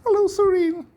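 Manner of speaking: very high, loud mock-cheery squeal; mocking greeting. Speaker taunts the CIA agent Surin, mocking high squeal. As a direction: call out teasingly in a piercing falsetto.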